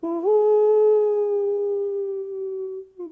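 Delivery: very long, high, loud wail; wailing howl. Shown as a demonstration. Preacher leading the congregation in a long wailing howl. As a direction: cry out like a mourning jackal. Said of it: "다 같이 시작".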